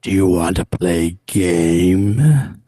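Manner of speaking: low, slow menacing whisper-growl; deep, slow creepy puppet voice; Jigsaw voice. Acted. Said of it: "the famous, scary voice".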